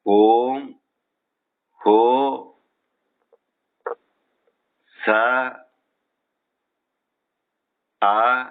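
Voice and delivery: "Om Ho Sa A Ya Namaha" spoken syllable by syllable with long pauses. Shown as a demonstration. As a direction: give each syllable of "Om Ho Sa A Ya Namaha" on its own. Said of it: "There are five syllables".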